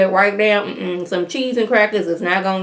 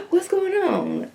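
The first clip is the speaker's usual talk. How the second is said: high, softer, drawn-out wonder; mock surprise; 11 semitones higher, 6 dB quieter, slower than usual. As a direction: act out an astonished crowd, high and slow. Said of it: "everybody's like".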